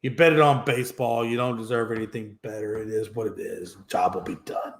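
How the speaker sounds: a hint of a contemptuous Rob Manfred; dismissive brush-off. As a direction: mutter it like a cold boss waving him off. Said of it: "basically response to that was".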